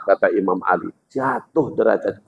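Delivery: ordinary talk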